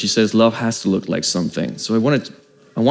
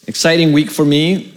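mock-excited, raised pitch; sarcastic; 4 semitones higher than usual. Real, not acted. Preacher joking to congregation about his week, sarcastic. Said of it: "That's sarcasm".

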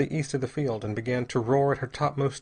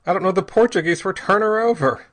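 loud, high-pitched savage exasperation; angry yell, pitched up; 7 semitones higher, 8 dB louder than usual. A narrator's reading. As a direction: shout it in savage frustration. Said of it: "said Bell savagely".

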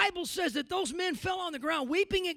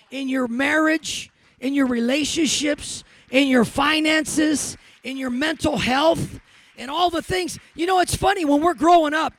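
preaching at full force; 12 dB louder than usual. Acted. Preacher promising his congregation God never forgets, full-force preaching.

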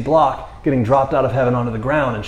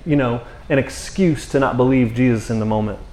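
normal talking voice

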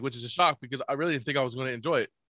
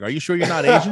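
laughing; 4 semitones higher, 8 dB louder than usual. Retold or imitated. Quoting friends while laughing.

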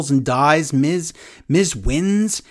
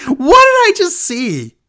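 high, loud, drawn-out bewilderment; astonished disbelief; 13 semitones higher, 7 dB louder, slower than usual. Acted. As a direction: exclaim it as if stunned. Astonished reaction to wrestling storyline.

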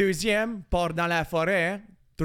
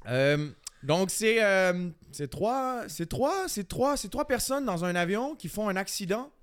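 halting mock French-from-France accent; accent français de France. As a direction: tell it in an imitated French accent. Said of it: "j'ai chié l'autre avec l'accent français". (faux accent de France hésitant)

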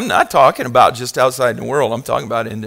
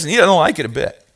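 ordinary delivery

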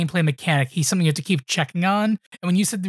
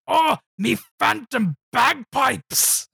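Scotsman from Samurai Jack; 6 semitones higher, much slower than usual. Acted. Cartoon Scotsman exclaiming, hammed-up Scottish accent. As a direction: bellow it slowly in higher mock Scottish. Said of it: "do the Scotsman from Samurai Jack".

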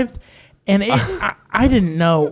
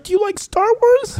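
high, eager dad voice asking his son; 13 semitones higher than usual. Pretend. Sean's friend plays a father meeting his son, eager and high. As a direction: pitch it up like an excited new father.

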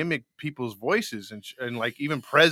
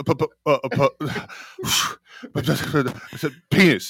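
stuttering impression. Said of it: "you gotta say it like Tone".

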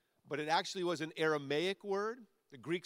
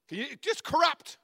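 exasperated exclaim; 10 semitones higher, 8 dB louder than usual. Real, not acted. Preacher exclaims in disbelief.

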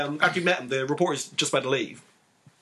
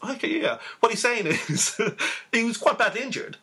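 translator; 7 semitones higher than usual. Retold or imitated. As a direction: play the eager interpreter, stretched out. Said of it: "then the translator is".

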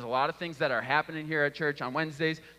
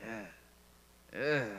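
disgusted groan; 6 semitones lower, 6 dB quieter than usual. Retold or imitated. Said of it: "and it's like".

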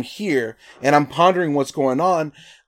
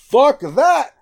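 high, loud "fuck that" blurted out; outraged refusal; 12 semitones higher, 6 dB louder than usual. Retold or imitated. Told to surrender his phone, he blurts a loud refusal.

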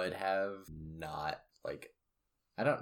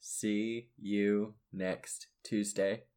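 very slow, each word drawn out; slow spelled out; much slower than usual. Shown as a demonstration. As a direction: spell it out slowly for her. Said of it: "Have you never heard that?".

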